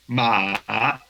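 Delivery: drawn-out dipping ma; third tone. Shown as a demonstration. Teacher repeating the example, falling then rising ma. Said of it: "bajamos la entonación y luego la subimos".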